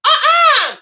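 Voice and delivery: loud, high-pitched refusal; emphatic, appalled head-shake of a sound; comically outraged refusal; mock disbelief. Acts out refusal after gasp.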